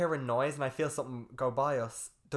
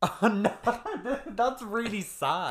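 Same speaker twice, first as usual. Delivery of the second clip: higher, laughing groan of embarrassment; real laughing; 8 semitones higher, 4 dB louder, slower than usual. Real, not acted. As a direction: laugh through mock dismay. Reacts laughing to topic.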